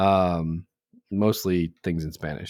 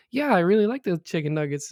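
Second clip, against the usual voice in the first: high-pitched imitation of a little girl; 8 semitones higher, faster than usual. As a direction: mimic a small child, pitched well up. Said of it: "Little girl goes".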